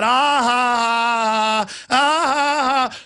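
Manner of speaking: high, slowed mocking cackle; mock laughter. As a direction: ape their jeering laugh. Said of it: "And they laugh at that".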